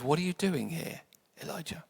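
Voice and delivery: softly asked, the name nearly vanishing; quiet, gentle. Retold or imitated. God asking Elijah the same question, gently. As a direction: ask softly, let the name fade. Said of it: "speak quietly and gently to him".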